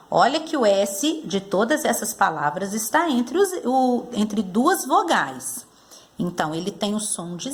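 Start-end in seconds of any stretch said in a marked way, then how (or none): none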